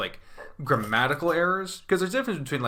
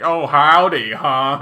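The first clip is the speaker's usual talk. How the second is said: loud, raised mock howdy; mocking hecklers; 3 semitones higher, 10 dB louder, slower than usual. People up north mocking a Southern accent, loud mockery. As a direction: mimic them jeering, much louder. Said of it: "people would be like".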